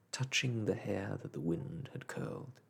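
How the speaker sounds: ordinary delivery, nothing marked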